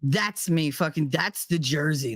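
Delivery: ordinary delivery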